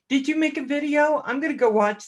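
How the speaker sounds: light up greeting a viewer; excited question. Real, not acted. Repeats eager question.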